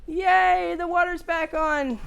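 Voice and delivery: high, loud cheer, put on; mock celebration. Repair customer retelling water's return, mock celebration.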